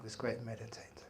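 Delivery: a hushed storyteller's aside; dramatic whisper. A narrator's reading. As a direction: whisper it for suspense. Suspense in snake story.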